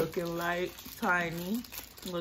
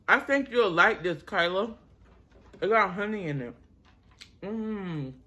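eating while talking; chewing; 3 semitones higher than usual. Real, not acted. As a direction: mouth partly full. Seen 2: Woman bit muffin at -5; jaw keeps chewing while humming.

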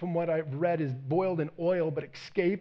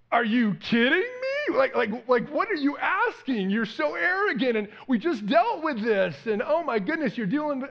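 higher, louder, incredulous rant; exasperated outburst; 7 semitones higher, 5 dB louder than usual. Pretend. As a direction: blow up in disbelief at the arrogance. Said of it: "that would be my temptation".

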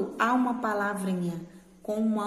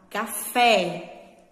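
"café" with FÉ louder and drawn out; stress on final syllable; 4 dB louder than usual. Shown as a demonstration. Teacher showing pupils the strong syllable, final FÉ stressed in "café". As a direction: linger hard on the FÉ of "café". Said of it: "que eu demorei mais".

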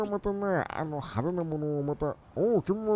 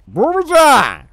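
loud, high shout of a name; calling out; 7 semitones higher, 14 dB louder than usual. Pretend. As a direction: holler to get their attention. 人に呼びかける. (大声で呼びかける)

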